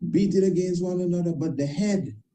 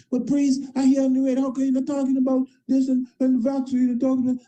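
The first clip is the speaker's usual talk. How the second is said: higher, louder imitated questioner; mock questioner; 8 semitones higher, 3 dB louder, faster than usual. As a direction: parrot the nagging people, raised pitch. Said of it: "People see me all the time".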